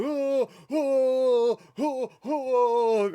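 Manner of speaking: high, loud mock groans of waking up; mock groaning. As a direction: ham up a pained waking groan. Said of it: "He's like".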